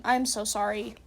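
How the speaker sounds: usual speaking voice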